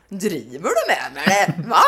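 slow, doubtful teasing question; incredulous dad. Retold or imitated. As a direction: play the father who thinks she's joking. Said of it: "Han bara". (pappa som tror hon skämtar)

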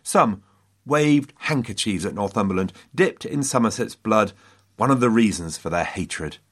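ordinary delivery

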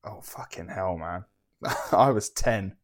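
usual speaking voice

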